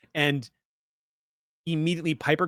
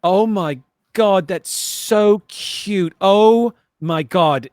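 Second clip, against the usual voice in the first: high, loud, drawn-out squeal of delight; gushing; 6 semitones higher, 6 dB louder, much slower than usual. Acted. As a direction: gush slowly over an adorable pet. Tara acts gushing over cute pet.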